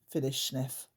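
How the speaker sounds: plain reading voice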